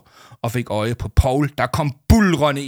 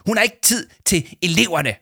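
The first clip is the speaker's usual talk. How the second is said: shouting; 8 semitones higher than usual. A narrator's reading. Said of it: "råbte Leo Manchot".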